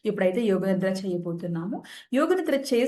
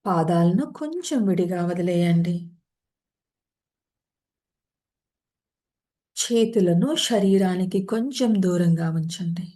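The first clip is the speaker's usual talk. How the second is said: guided meditation; 4 dB louder than usual. Acted. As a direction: ease listeners into rest, one unhurried phrase at a time. Leads a yoga nidra session.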